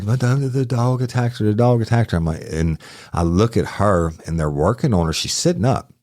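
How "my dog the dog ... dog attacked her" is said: a hint of a distraught old husband, higher; mild imitation of a crying husband; upset old man. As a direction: plead it like a shaken old man. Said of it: "he's crying".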